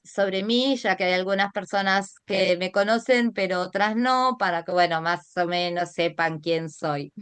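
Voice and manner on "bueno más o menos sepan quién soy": ordinary talk, nothing marked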